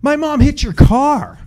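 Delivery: high, loud mock excited teen; excited girl. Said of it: "She's like".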